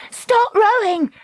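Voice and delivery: high, drawn-out cry; urgent cry. A narrator's reading. Dakota to the rowers as the man grabs the boat, urgent cry. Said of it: "Dakota cried".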